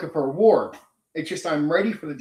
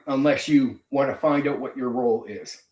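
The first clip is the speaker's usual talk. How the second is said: ordinary delivery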